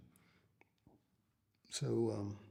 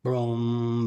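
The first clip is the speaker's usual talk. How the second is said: bang out the strum with more force; louder guitar strum; 7 dB louder than usual. Retold or imitated. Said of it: "then I went F".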